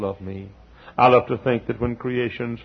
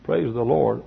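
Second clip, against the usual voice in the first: ordinary talk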